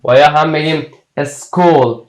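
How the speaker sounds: ordinary talk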